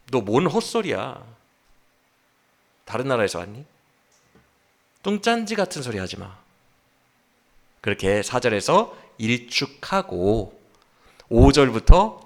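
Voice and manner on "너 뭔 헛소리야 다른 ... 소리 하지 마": low-key, softly scornful rebuke; quiet dismissal. Acted. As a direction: brush them off in a hushed voice. Voices scornful dismissal of friend. (나직하게 일축하는 말투)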